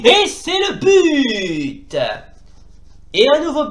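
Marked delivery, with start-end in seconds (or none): 0.1-2.0 slow, loud, drawn-out goal shout; goal call. Acted. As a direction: call the goal like a stadium commentator. Commentator goal call in game.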